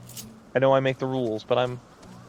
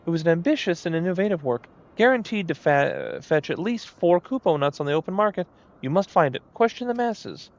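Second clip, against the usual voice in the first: high, showy moogle painter; a vain little artist moogle, raised pitch; 4 semitones higher than usual. Acted.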